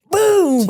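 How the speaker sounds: loud, high imitated impact; shouted boom. Storyteller acting out an arm yanked into the wall, shouted boom.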